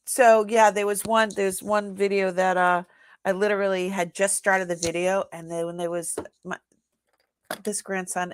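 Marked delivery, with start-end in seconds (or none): none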